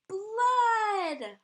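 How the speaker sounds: long, high, very loud shout; yelled alarm. A narrator's reading. Loretta shows police her brother, long high yell. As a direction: stretch it into a siren-like yell. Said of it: "pointed at her brother and yelled".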